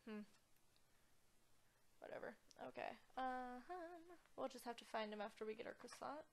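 ordinary talk

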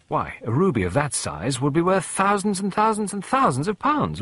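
high, rising schoolboy excitement; excited marvelling. A narrator's reading. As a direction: build with each repeated "thousands". Boy marvels at the ruby's worth.

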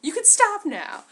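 high, loud whiny begging; pleading minion. Retold or imitated. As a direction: beg like a beaten goon. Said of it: "he's like".

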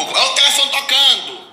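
high, loud call across the house. Real, not acted. Telling Lucas from the bathroom that the phone rings, loud call. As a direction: yell that the phone is ringing.

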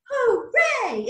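hooray leaps high; cheering hooray. Shown as a demonstration. Song shout hooray.

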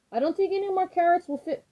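high-pitched worried squirrel. Acted. Squirrel warns the house is too full.

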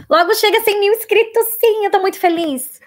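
beaming over the milestone, pitch jumping up; excited. Real, not acted. Excited about subscriber milestone. (animada com os cem mil inscritos)

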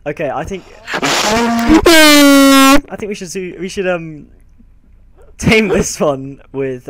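loud, slow, high amazed outburst; amazed shout. Real, not acted. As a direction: shout in disbelief. Amazed by fast horse.

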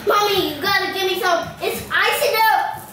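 ordinary talk, nothing marked

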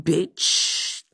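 ordinary talk

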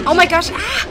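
high, loud frightened cry; real startled panic; scared shriek. Real, not acted. Enemy attacks inside cage.

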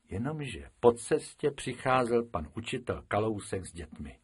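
ordinary delivery

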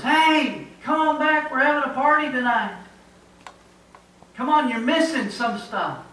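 loud, high shouting from the shore; loud, high beckoning yell; calling out. Retold or imitated. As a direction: call them back across the water like a party crowd. People on shore yelling back.

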